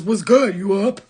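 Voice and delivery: loud mock booty text; mocking men's texts. Mocking men who text late at night, loud mock voice. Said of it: "They're just texting us".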